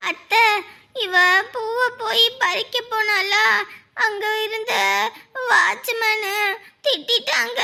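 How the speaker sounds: high, slow crying report; tearful complaint. Acted. Child telling aunt the watchman scolded them, tearfully. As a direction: whine it out through tears. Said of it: "ஏன் அழுதுட்டு வரீங்க?".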